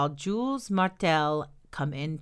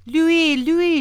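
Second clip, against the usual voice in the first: high, loud call for help; breathless cry; 11 semitones higher, 9 dB louder than usual. A narrator's reading. Old woman reaching the next farm, crying out breathless. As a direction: cry out the neighbor's name urgently. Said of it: "she cried out of breath".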